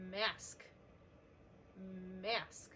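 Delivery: long hummed M opening Mask; stretched first sound. Shown as a demonstration. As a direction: hold the M before finishing Mask. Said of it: "I don't hear a t sound in the mask".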